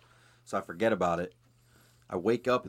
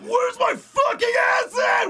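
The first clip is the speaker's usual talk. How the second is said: slow, very high, full-volume yell; mock furious shout; 9 dB louder, slower than usual. Imagined storming into Whole Foods after the dealer, mock furious shout. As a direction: holler it out in hammed-up outrage. Imagined confrontation in grocery store.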